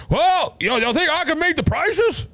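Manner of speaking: high mock Biden voice; bad Biden impression. Host mocking the president's excuse, in a high put-on voice. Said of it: "I know that's a bad Biden impression".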